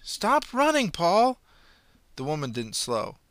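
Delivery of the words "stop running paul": high, loud shout; shouted command. Acted. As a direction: call out while chasing. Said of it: "she yelled".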